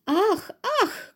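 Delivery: high startled shriek; frightened cry. A narrator's reading. Mother spots her coat moving, frightened high shriek. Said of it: "krzyknęła mama".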